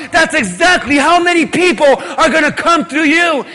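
loud, high, excited promise; joyful proclamation. Acted. God promising Abraham, shouting with joy. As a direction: boom out the promise with delight. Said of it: "God said".

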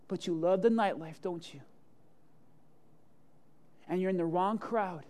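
normal talking voice

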